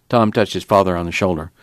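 usual speaking voice

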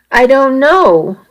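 unsure and unhappy, dragged out; unhappily. A narrator's reading. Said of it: "I answered unhappily".

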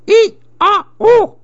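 shriek, gasp, shriek, all high; monkey shrieks. A narrator's reading. Frightened monkey startled by a voice, high shrieks.

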